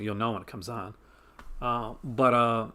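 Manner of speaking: ordinary talk, nothing marked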